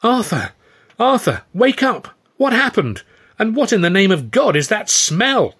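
high, loud, alarmed calls to wake him; urgent shouting. A narrator's reading. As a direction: Stan shaking Arthur awake, shouting high and alarmed. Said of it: "Stan was shaking me vigorously".

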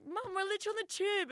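high-pitched pleading with mum; embarrassed protest. Retold or imitated. Daughter reminding mum they're on the tube, high mock plea. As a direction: plead as a mortified daughter, retold.

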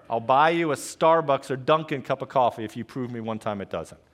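usual speaking voice

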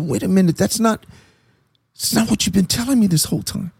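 retold confusion. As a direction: question it in puzzled disbelief, reenacted. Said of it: "I was so confused".

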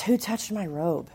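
quiet question. Acted. Jesus in the crowd quietly asking who touched him. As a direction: ask it gently under the breath.

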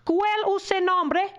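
high, drawn-out foreign question; imitating Spanish-speaking mother. Perla's mother asks his mother his name, imitated high and slow. Retold mother asking across language gap.

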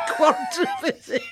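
shouted high through laughter; mock shout while laughing. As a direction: cry it out, cracking up.

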